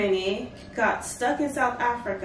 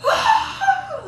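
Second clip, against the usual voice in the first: high, loud surprised shriek of a name; delighted high-pitched squeal; mock scream; 10 dB louder than usual. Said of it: "Like, screamed".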